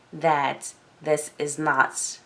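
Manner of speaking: ordinary talk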